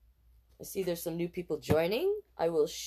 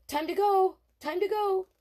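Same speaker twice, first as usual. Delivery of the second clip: high, urgent mom voice; mom in a hurry; 13 semitones higher, 5 dB louder than usual. Acted. Mom fleeing the bear in a hurry. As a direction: hurry everyone out. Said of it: "Donovan's mom said".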